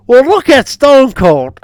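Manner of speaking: high, loud exasperated order; imitated angry shout. Friend telling the biased referee where to look, mock exasperated shout.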